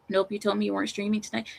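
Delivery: ordinary talk, nothing marked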